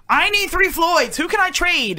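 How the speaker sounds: high-pitched retold plea; high voiced quote. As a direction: voice himself high and eager. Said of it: "I'd be like".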